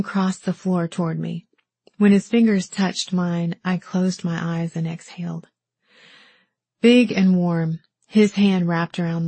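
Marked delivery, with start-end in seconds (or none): none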